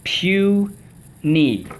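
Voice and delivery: PUNY stretched into two high, separate beats; syllable by syllable. Shown as a demonstration. Teacher leading the class in a drill, syllable by syllable. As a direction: sound out PUNY as pew, then knee. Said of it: "Puny, let's go".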